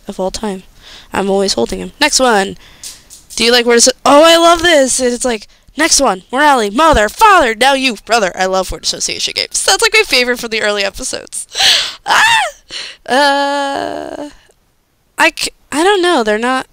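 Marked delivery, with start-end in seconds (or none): 4.1-5.0 excited. Real, not acted. Loves the question. 12.0-12.4 high squealing laugh that breaks into a shriek. Real, not acted. Fan reacting to a funny quiz line, shrieking laugh.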